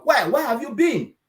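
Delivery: high, eager customer voice; light mock excitement, pitch jumping up; retelling delighted customers calling out; excited customers. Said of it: "customers voiced thanking the team".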